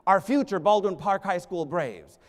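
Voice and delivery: usual speaking voice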